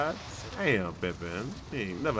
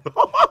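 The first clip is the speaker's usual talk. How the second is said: sudden high loud laugh; loud burst of laughter; 12 dB louder than usual. Real, not acted. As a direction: crack up loudly at the joke.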